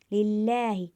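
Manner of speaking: लिल्लाही chanted, holding the ला for one alif's length; Quranic recitation, stretched. Shown as a demonstration. Teacher reciting लिल्लाही to students, ला stretched. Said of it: "एक अलिफ के बराबर खींचकर पढ़ेंगे".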